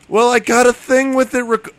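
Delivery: put-on high, eager kid voice; high-pitched Phil. Kid telling friends about his recording, high-pitched. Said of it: "high pitch Phil".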